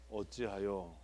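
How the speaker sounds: soft, mournful 어찌하여 falling away; hushed lament reading. Shown as a demonstration. Teacher shows the textbook way to start, softly mournful. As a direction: breathe 어찌하여 like a sad dirge. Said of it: "이렇게 하면 안 되는 방법으로".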